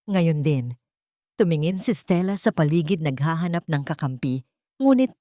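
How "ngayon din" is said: plain reading voice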